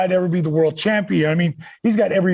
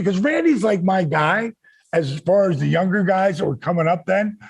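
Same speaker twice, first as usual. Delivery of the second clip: ordinary talk, nothing marked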